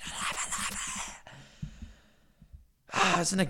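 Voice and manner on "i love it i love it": whispered. Real, not acted. Repeated, fading to a whisper.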